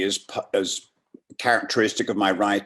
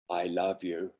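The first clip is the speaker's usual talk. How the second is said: put-on soft, low I love you in a northern accent; quiet; 5 semitones lower, 9 dB quieter, faster than usual. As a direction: murmur it to yourself first. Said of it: "Repeat out loud".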